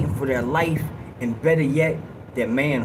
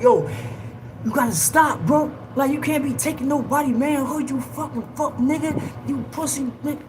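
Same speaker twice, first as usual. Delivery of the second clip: angry yelling; 11 semitones higher than usual. Retold or imitated. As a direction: yell it across at him, furious. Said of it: "yelling over".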